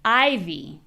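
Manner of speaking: stressed I in "ivy"; first-syllable stress. Shown as a demonstration. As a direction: punch the I of "ivy", let "vy" fall. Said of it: "stress is on the first syllable".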